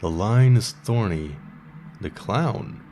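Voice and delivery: slam poetry reading. Acted. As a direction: perform it like a slam poet on a small stage, letting each line land. Host performs slam poem on air.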